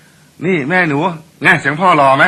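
proud husband. Retold or imitated. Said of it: "เสียงปกติเลยนะ".

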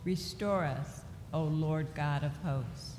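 ordinary talk, nothing marked

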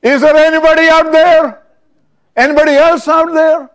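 high, loud panicked shout; desperate call. Retold or imitated. The man refuses God and yells for anyone else, panicked. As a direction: yell into the void, hoping for someone else.

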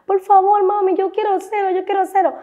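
put-on high-pitched child begging repeatedly; niña rogando. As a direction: plead like an insistent little girl, way up high. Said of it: "la niña, yo veía que cuando". (vocecita aguda de niña suplicando)

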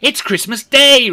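very high, loud burst of delight; overjoyed exclamation. A narrator's reading. Scrooge repeating the news to himself, overjoyed. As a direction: cry it out in amazed joy. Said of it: "repeated Rishi Scrooge to himself".

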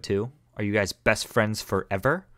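ordinary talk, nothing marked

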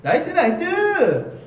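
high, slow called-out 라이트, 라이트; stage call. Retold or imitated. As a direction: call out 라이트 like a show cue. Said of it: "지금 했으면은 그거 했겠다".

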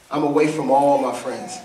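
very low, subdued voice of John; understated grief, sunk deep in pitch; weeping John's answer. Acted. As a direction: answer low and heavy, without sobbing. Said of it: "he's sobbing uncontrollably".

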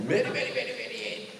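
low, unvoiced tearing sound effect; imitated ripping noise. Speaker adding sound effects to his imagined filter removal, imitated ripping. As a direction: make a rough peeling noise with the mouth. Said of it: "自分で効果音を".

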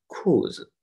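裤子 with stressed 裤 and light 子; neutral-tone demo. Shown as a demonstration. Teacher shows students the light tone on 子 in 裤子. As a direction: punch 裤, let 子 fall away short. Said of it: "就轻一声啊".

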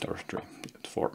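unvoiced whisper to herself while counting; whispered aside. Real, not acted. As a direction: whisper the correction under your breath. Muttered self-correction aside.

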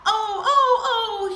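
high, loud mock wail; wailing call. A narrator's reading. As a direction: call out in exaggerated pain. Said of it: "he called out".

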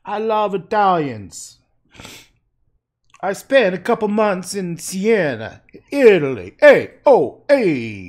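loud, high, drawn-out Italian caricature; mock Italian. Painter declares love for Italians, mock Italian shouting. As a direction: shout it with a hammed-up Italian accent. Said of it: "they don't really talk like that".

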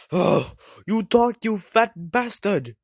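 slow taunt. Pretend. Taunting Santa.

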